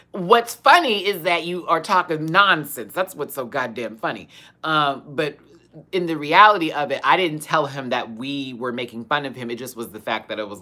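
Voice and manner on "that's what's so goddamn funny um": ordinary talk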